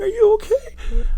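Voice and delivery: very high, slow mock-tearful girlfriend voice; imitated crying. Wrestler imitating the upset girlfriend at the show, mock crying. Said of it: "she's, like, crying".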